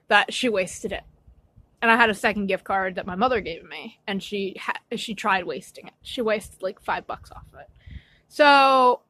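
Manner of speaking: ordinary delivery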